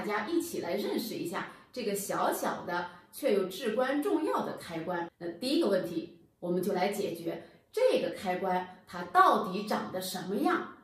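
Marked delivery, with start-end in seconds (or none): none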